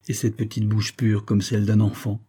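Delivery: ordinary delivery, nothing marked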